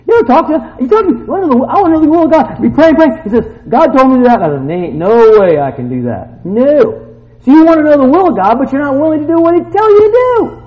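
high, loud preaching shout; full-force preaching. Real, not acted. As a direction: preach it at the top of your voice.

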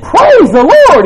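loud and high, drawn out slowly; loud slow praise. Retold or imitated. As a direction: proclaim it slowly at full volume. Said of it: "But I told them, I said".

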